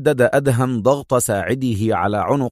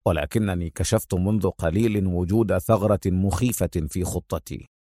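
stern, composed hero; 4 semitones lower than usual. Acted. Hero explains plan to partner.

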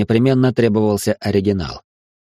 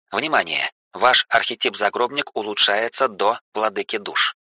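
softer, even system log read; robotic error message; 5 dB quieter than usual. Acted. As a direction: announce errors like a machine log.

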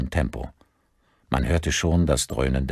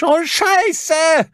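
very high, loud panicked yell; panicked shout; 7 dB louder than usual. A narrator's reading. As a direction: scream the curse in alarm. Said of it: "rief sein Sohn panisch".

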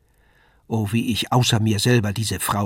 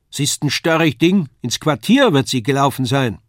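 high, loud grumble across the hall; calling back; 4 semitones higher, 6 dB louder than usual. A narrator's reading. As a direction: complain loudly about the stubborn child. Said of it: "rief diese wieder".

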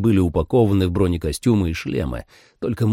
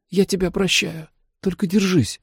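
high voice on the edge of sobs; tearful pleading; 11 semitones higher than usual. A narrator's reading. Daughter urging her dying father to hold on, tearful. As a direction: plead through tears. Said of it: "всхлипнула Джули".

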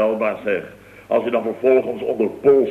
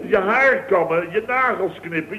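mock servile fawning; 7 semitones higher than usual. Acts servile toward Paul as a bit.

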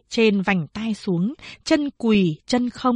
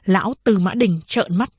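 usual speaking voice

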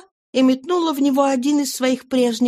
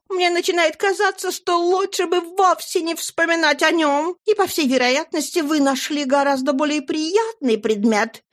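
high, fluttery chatter; gushing lady; 8 semitones higher than usual. Acted. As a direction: gush like a flustered old flame. Flora's gushing speech.

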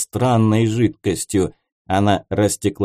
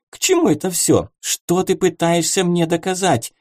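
higher, imperious princess voice; haughty princess; 7 semitones higher than usual. A narrator's reading. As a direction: command like a queen. Princess demands obedience.

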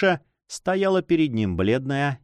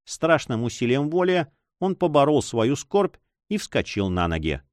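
usual speaking voice

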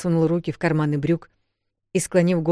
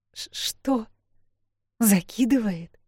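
stunned; 5 semitones higher, slower than usual. A narrator's reading. Sister stunned by strange words.

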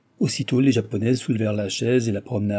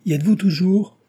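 raised, higher and louder questioning call; 9 semitones higher, 6 dB louder than usual. A narrator's reading. Guests check on the vanishing Jean, calling out. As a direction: call to check someone is still there. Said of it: "crièrent-ils".

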